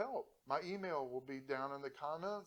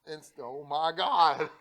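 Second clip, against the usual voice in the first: breaking into laughter mid-word; cracking up, words dissolving; laughing; 12 dB louder, slower than usual. Real, not acted. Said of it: "that's why I'm laughing".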